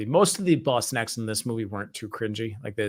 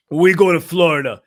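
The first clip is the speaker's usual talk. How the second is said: put-on loud, slow, heavy Russian accent; imitating a Russian mobster's accent; 5 semitones higher, 9 dB louder, slower than usual. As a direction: growl it like a Russian mobster giving orders. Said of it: "this Russian mobster ... He just goes".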